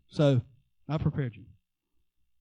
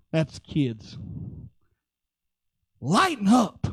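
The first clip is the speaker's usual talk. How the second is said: usual speaking voice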